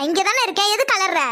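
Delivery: normal talking voice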